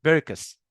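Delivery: بيركس with a "k" where "ch" belongs; common error. Shown as a demonstration. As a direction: mispronounce purchase as بيركس, hard "k". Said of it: "بعضنا بينطقها".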